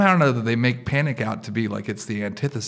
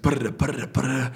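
rapid rolling punches imitated; speed bag noise; 3 semitones higher, faster than usual. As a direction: mimic a boxer's speed bag with your mouth. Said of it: "like Rocky on the speed bag".

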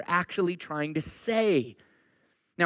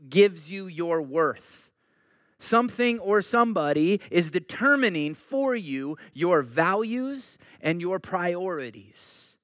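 ordinary talk

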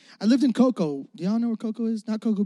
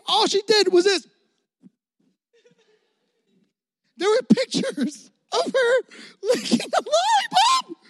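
mocking disbelief; 10 semitones higher than usual. Mocking rival's lollipop project.

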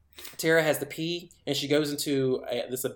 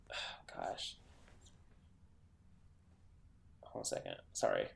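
whispered, much softer aside; muttered aside; 17 dB quieter than usual. Real, not acted. Storyteller losing her thread, whispering to herself. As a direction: drop to a breathy aside to herself.

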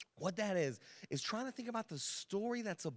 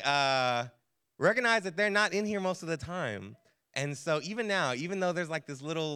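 ordinary talk

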